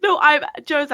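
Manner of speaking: real laughter getting into the words; talking through a fit of laughter; laughing too hard. Real, not acted. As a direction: start high and loud, break off laughing. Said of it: "I'm laughing too hard".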